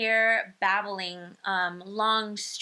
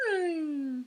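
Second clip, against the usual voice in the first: put-on baby coo; 8 semitones higher than usual. Shown as a demonstration. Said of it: "you might hear them go".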